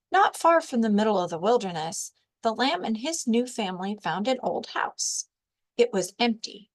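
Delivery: plain reading voice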